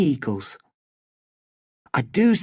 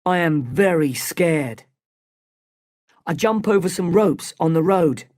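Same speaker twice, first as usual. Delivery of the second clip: usual speaking voice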